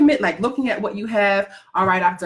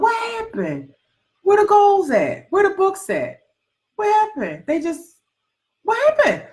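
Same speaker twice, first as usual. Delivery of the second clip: high, incredulous scolding "what happened?"; mock exasperated scolding; 8 semitones higher than usual. Coach mock-scolding viewers who put off their goals, high and incredulous. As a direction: playfully tell off the procrastinators in a raised voice.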